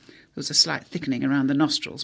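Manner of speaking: ordinary delivery, nothing marked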